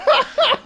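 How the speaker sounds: high loud real laugh; burst of laughter. Real, not acted. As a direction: crack up at the bad accent. Friends crack up at accent.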